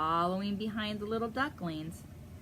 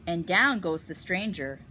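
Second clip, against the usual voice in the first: louder dramatic read-aloud narration; storytelling voice; 8 dB louder than usual. A narrator's reading. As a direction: read it with suspense for a child. Reading picture book aloud.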